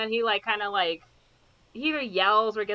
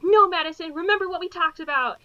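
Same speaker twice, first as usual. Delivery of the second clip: higher, louder warning mother; higher-pitched mom's firm reminder; 6 semitones higher, 5 dB louder than usual. Retold or imitated. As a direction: rein her in firmly. Mom warning daughter.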